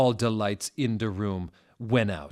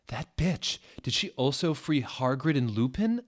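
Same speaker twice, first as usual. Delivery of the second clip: indignant outburst, pitched higher; angry shout; 6 semitones higher, 3 dB louder than usual. A narrator's reading. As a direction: snap out the insult in outrage. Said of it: "I shouted angrily".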